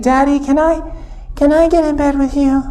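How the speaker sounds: put-on high, small pleading child; a scared kid asking to sleep with dad; little girl voice.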